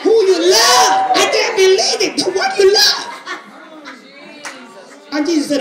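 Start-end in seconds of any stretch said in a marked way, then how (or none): 0.0-3.1 high-pitched mock outrage, drawn out; manipulative wailing. Preacher mimicking Mary and Martha's manipulation, high mock wail. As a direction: wail it in exaggerated disbelief. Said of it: "all that is manipulation".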